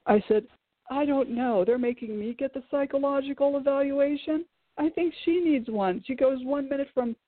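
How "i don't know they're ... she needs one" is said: raised-pitch sarcastic jab, higher; mocking the wife who needs evaluation, higher and sarcastic; sarcastic retort. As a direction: toss off a snarky dig about the wife. Snapping back at attorney about wife.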